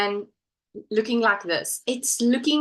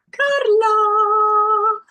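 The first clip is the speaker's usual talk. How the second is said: high, loud delighted cry of the name; excited call; 12 semitones higher, 7 dB louder than usual. Real, not acted. Host spotting a viewer's comment, calling her name excitedly. Greeting a viewer who appears.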